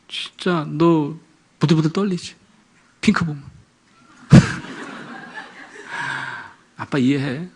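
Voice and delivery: tender empathy. Retold or imitated. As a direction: linger gently on each word. Father empathizing with daughter.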